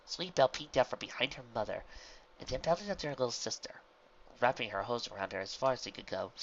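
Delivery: ordinary delivery, nothing marked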